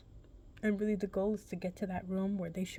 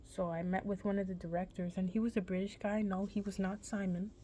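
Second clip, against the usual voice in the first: ordinary delivery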